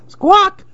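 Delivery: very high startled squawk; parrot squawk. A narrator's reading. Parrot sees the chameleon at last, high squawk. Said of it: "said Polly".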